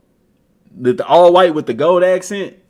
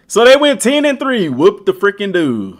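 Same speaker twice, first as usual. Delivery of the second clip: loud, high sarcastic dismissal; sarcastic whoop-de-do; mocking scoff; 6 semitones higher, 5 dB louder than usual. Real, not acted. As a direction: scoff at their record out loud. Mocking rival's record.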